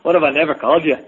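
mock a Brit, accent slipping toward Irish; badly faked British accent; botched British accent. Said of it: "I can't do a British accent".

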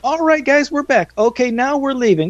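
high-pitched parody of a podcast host; mock host sign-off. Co-host teasing the dropped caller, mock host voice. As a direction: chirp it as a joke host impression.

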